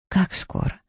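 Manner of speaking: whispered tense Как скоро; pressing question. A narrator's reading.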